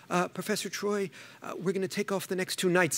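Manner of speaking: hesitant, hushed imitation of a timid student; nervous student. Student asking professor for nights off, mock nervous. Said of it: "they're so nervous 'cause they're speaking".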